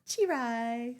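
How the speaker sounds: French fry said as "chee-rye", "Chirai"; baby-talk "Chirai", softly drawn out; toddler mispronunciation. Shown as a demonstration. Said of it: "I couldn't say French fry".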